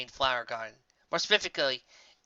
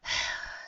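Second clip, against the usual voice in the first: soft breathy sigh out; a long tired exhale; weary sigh; 7 dB quieter than usual. Acted. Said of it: "the unicorn sighed".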